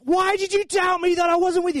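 high, loud shout over wind; strained-to-be-heard acted yell. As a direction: holler it above the storm. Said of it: "he's having to shout over the".

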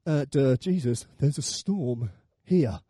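low, slow "duh" voice; deadpan dim-witted retort; stating the obvious. Acted. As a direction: point out the obvious, dragging it. Peter's imagined retort to Jesus.

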